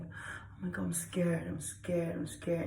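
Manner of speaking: hushed, acted scared repetition; nervous, fearful. Shown as a demonstration. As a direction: whimper it softly as if frightened. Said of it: "when you're nervous, you're like".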